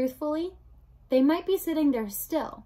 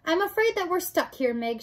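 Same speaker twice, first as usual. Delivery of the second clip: mildly shouted, higher pitch; raised voice; 7 semitones higher, 3 dB louder than usual. Acted. Princess tells Mig they are stuck, mild shout. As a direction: call it out to her, lightly raised. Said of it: "shouted the princess".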